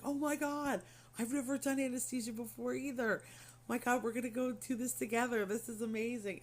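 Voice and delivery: high-pitched, thrilled gush; mock excitement. Anesthesia guy tells patient it's his first time too, mock excitement.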